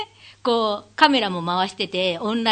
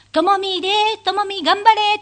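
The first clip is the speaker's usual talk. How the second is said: cheering fan comment; 11 semitones higher, 5 dB louder than usual. Shown as a demonstration. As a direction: shout support like a fan. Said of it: "とコメントをつけながら". (元気な応援の声)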